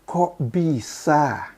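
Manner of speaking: high, very slow mock disbelief; puzzled surprise. Real, not acted. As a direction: drag it out as if you can't believe it. Puzzled by dictionary contradiction.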